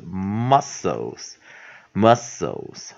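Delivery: MUSCLES stretched as muss-ols; slow pronunciation demo. Shown as a demonstration. Teacher marking up the word, slow pronunciation demo. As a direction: model MUSCLES in two slow syllables, lengthened m. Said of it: "再看老师再标一次".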